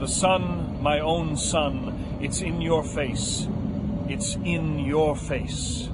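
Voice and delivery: slow, savoring each word of the lyric; slow translation. Shown as a demonstration. Professor translating the Italian song for the deputy, slow and tender. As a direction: read the lyric slowly and lovingly. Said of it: "a man is singing to his lover".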